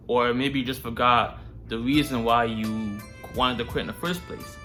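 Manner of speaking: normal talking voice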